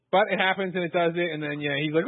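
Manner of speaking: ordinary talk, nothing marked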